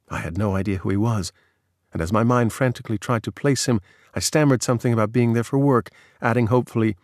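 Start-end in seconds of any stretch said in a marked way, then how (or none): none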